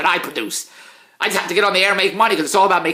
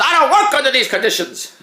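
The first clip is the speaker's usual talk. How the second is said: mocking the editor; 7 semitones higher, 4 dB louder than usual. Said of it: "as she says".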